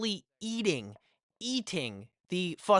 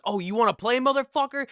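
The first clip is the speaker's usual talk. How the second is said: loud, raised retort, squaring up; riled-up challenge; 8 semitones higher, 6 dB louder than usual. Retold or imitated. Storyteller retelling his reaction to being tackled, riled-up challenge. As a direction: throw it back as a higher, louder dare.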